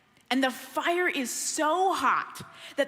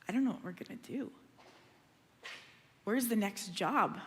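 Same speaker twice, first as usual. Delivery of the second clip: worried quiet; 5 semitones lower, 6 dB quieter than usual. Retold or imitated. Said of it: "we've sat there and gone".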